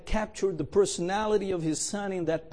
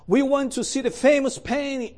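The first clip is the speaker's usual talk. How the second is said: raised, higher heckling shout; 8 semitones higher, 4 dB louder than usual. Acted. Heckler at the auction calls out, raised shout. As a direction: call out from the back in protest. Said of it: "a voice in the back of the room shouted".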